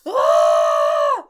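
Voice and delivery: sharp loud fake gasp of fright; mock gasp. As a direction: gasp as if the candle just lit. Candle lights up by itself.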